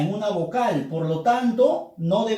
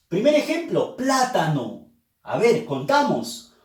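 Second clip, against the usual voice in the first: ordinary talk, nothing marked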